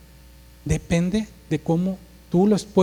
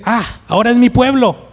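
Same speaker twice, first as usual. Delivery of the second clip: loud, higher-pitched indignant retort; heated Moses; 6 semitones higher, 5 dB louder than usual. Acted. Moses throws the blame back at God, heated and blunt. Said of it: "Empieza un arrebato".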